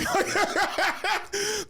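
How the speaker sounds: high-pitched cackle; laughing. Real, not acted. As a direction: crack up at your own joke. Cracks up at own joke.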